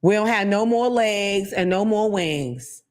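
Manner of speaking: usual speaking voice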